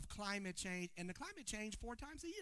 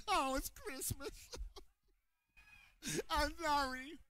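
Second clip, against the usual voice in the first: laughing apology; 7 semitones higher, 4 dB louder than usual. Real, not acted. Breaks off laughing mid-sermon.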